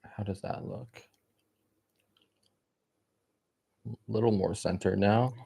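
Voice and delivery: speaking with gum in mouth; chewing gum. Real, not acted. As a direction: chew gum between words. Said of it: "Let me get rid of this gum".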